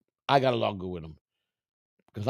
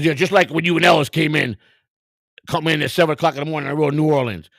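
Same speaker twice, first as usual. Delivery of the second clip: loud, higher retold accusation; accusing manager; 5 semitones higher, 6 dB louder than usual. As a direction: act out the boss calling out a lie. Manager confronts him, retold.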